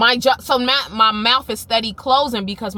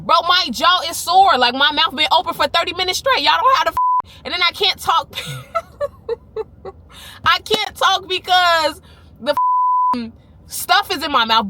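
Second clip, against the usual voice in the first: exasperated; 8 semitones higher, 4 dB louder than usual. Real, not acted. Venting, laughing, jaw sore.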